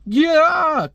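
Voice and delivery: high yelled "yeah"; battle cry. Acted. Armor swinging a car around, high whooping cry. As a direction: let out a high charging whoop. Car comes flying over.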